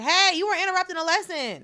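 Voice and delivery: high, loud mock telling-off; loud scolding. Student scolding a classmate in math class, loud and high. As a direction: snap loudly at a classmate. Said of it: "I was like".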